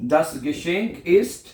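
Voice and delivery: each word very drawn out; very slow dictation. Shown as a demonstration. Teacher dictating das Geschenk ist very slowly. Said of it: "क्या बनेगा?".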